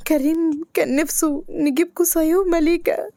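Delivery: high, crying, pained voice; weak tearful plea. Acted. Dying patient entrusting her son to her friend, tearful. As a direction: beg her friend through pain and tears. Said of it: "بنين بتعب وألم".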